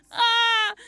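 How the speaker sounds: sharp high cry of mock triumph; loud high yelp. Real, not acted. Streamer yelping about her bravery on the plane.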